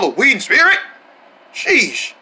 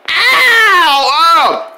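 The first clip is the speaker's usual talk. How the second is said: loud, high cry of pain; pained yelp; 7 semitones higher, 5 dB louder than usual. Acted. Friends land after falling from the window, loud pained yelp. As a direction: yelp as if hitting the ground.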